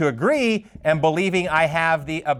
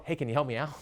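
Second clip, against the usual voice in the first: confiding aside; 3 semitones lower, 10 dB quieter, faster than usual. Retold or imitated. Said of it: "walk out of the room and go".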